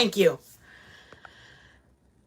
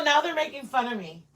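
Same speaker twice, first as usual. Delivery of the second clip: ordinary talk, nothing marked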